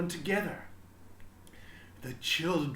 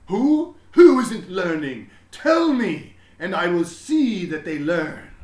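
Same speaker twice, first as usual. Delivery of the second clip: loud, high and pressing; urgent headmaster; 5 semitones higher, 15 dB louder than usual. A narrator's reading. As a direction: demand to know who, alarmed. Worried headmaster demanding.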